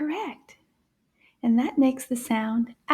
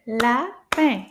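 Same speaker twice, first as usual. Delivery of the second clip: LAPIN in two firm, separated beats; clapped syllables; 4 dB louder than usual. Shown as a demonstration. Teacher clapping out "lapin" with children, syllable by syllable. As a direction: punch each syllable of "lapin" apart. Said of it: "Let's clap it together".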